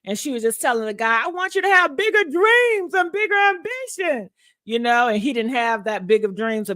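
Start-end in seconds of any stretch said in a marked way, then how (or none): 1.2-4.3 lightly voiced movie heroine, raised pitch; a hint of Babs pleading for more; mild impression of a film character; quoting Babs. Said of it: "I feel like Babs".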